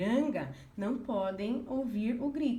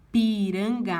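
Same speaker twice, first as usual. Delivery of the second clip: Piranga said louder, counted as one metric unit; scanning syllables; 7 dB louder, slower than usual. Shown as a demonstration. Teacher to students, counting Piranga loudly. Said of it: "fazendo a escansão".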